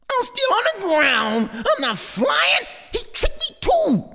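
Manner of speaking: put-on high, crying child's wail; squealing upset chick; baby hawk wail. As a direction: whine like a let-down little kid. Baby hawk wails failing to fly.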